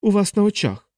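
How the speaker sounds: high, indignant insistence; exasperated anger. Acted. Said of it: "геть розлютився капелан".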